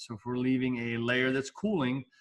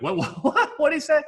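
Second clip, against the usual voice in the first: high, laughing what-did-he-say; mock bewilderment, pitch shooting up; confused passenger; 12 semitones higher, 4 dB louder than usual. Teacher playing a baffled passenger, high and laughing. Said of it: "And you'll be like".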